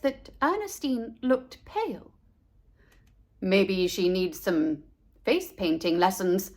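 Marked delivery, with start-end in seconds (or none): 3.4-6.5 lower, a hint of a puzzled dad; lightly voiced father, pondering. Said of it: "said Mister Buckmeister".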